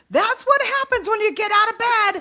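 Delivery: full lecture mode. Retold or imitated. Mom lecturing son who left bed, full lecture mode. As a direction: lay down the law like a parent lecturing. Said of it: "I'm totally in full lecture mode".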